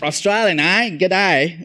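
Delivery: hammed-up Australian greeting; Australian accent. South African pastor teasing Australians, mock Australian accent. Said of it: "they have a really terrible accent".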